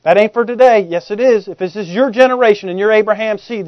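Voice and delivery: ordinary delivery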